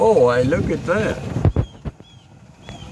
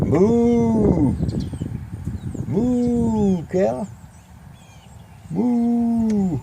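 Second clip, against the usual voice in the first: high drawn-out moo; cow moo; 8 semitones higher, much slower than usual. Shown as a demonstration.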